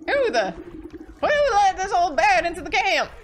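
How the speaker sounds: loud slow indignant yell; gruff officer. Acted. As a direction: demand answers angrily. Officer voiced.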